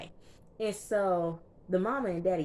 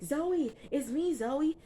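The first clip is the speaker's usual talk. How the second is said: high-pitched pleading call; mock frantic appeal; retold freaking out; 10 semitones higher, slower than usual. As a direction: plead for recognition, voice jumping up. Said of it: "Homie freaking out".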